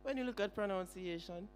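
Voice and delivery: ordinary delivery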